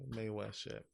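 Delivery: ordinary delivery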